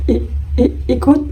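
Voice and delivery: hesitant, half-whispered stammer; unsure stutter. Acted. Shy boy asked to lunch, answers with an unsure stammer. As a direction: trip over the words, not sure at all. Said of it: "Nggak yakin banget".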